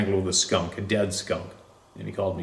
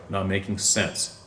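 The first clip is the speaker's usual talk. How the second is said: plain reading voice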